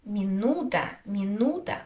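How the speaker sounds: mi-NU-ta, the "nu" in "minuta" held longer; stress on U. Shown as a demonstration. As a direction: hit the middle syllable of "minuta". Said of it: "Na u".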